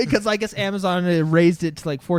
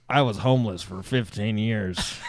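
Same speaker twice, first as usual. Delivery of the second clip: low, slow, mock-solemn testimonial; weighty ad confession, pitched way down; intense story; 10 semitones lower, 3 dB quieter, slower than usual. Amazon ad mail guy telling his past, mock-intense. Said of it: "like, intense story".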